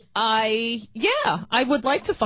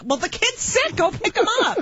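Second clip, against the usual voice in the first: exasperated order; 11 semitones higher than usual. Retold or imitated. Retold telling nanny off.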